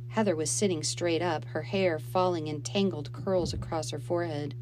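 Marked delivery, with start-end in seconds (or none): none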